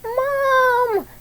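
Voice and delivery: high, loud cry for mum; shouted. Acted. Child waking from a nightmare shouts for mum. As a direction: yell out from a bad dream. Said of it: "Debbie shouted".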